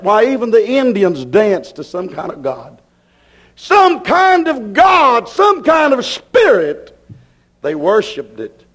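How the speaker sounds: normal talking voice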